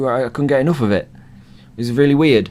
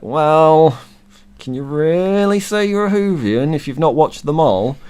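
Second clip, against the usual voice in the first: mock scepticism; 7 semitones higher, 3 dB louder than usual. Whovian challenging fans who skipped older Doctors, mock scepticism. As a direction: act out a pointed, sceptical challenge.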